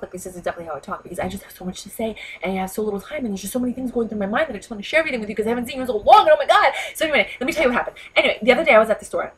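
fast, rushing chatterbox speed; talking really fast. Shown as a demonstration. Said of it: "that's how I would talk".

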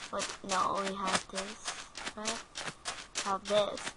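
ordinary talk, nothing marked

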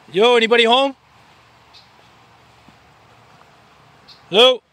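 loud, high call toward the tents. Real, not acted. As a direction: call out to see if anyone is home. Calling out to tent.

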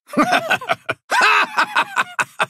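big booming sailor laugh; hearty laugh. Acted.

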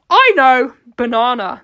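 loud burst of sudden inspiration; intense. A narrator's reading. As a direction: seize on the idea with intensity. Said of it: "they said with an intense".

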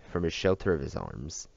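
ordinary delivery, nothing marked

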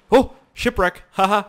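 loud, high excited discovery; thrilled shout of discovery. Real, not acted. Spots shipwreck excitedly.

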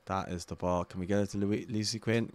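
ordinary delivery

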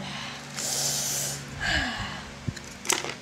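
long sigh. Real, not acted. Disappointed by duplicate toys.

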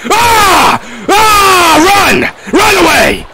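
very high, loud terrified screams; shrieking retreat; panicked screams. Real, not acted. Panicked flight from monster.

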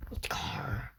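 breathy low whispered name. Real, not acted. Gamer whispering about the Edgar character, hushed. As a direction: whisper it conspiratorially.

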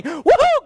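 high, loud whoop of delight; real happy church whoop; joyful whoop. Real, not acted. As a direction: holler with glee. Preacher whoops about teens.

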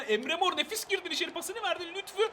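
loud, high rush of excitement; excited commentary. Real, not acted. Gamer following Emre Mor's run into the box, excited commentary. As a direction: call the attack with rising excitement. Live attack in the game.

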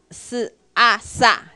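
"四啊萨" blended slowly, si then a then sa; sounding out sa. Shown as a demonstration. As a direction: slowly merge "四" and "啊" into "萨". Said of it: "这个S这边你就把它发音变成四". (把"四"和"啊"慢慢拼成"萨")

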